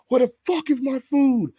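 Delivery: high mock yell of a rude customer. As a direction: play the entitled diner demanding his food. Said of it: "He was just like".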